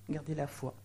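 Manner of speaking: quiet falling repeat of garder la foi; soft echo. Shown as a demonstration. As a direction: echo it back quietly to let it sink in.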